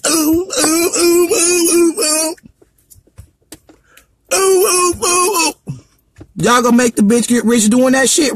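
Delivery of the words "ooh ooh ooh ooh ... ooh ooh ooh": higher-pitched imitated oohs; mocking wordless hooting. Mimicking the woman with no voice, high mock hooting. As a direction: hoot high in short repeated bursts, no words.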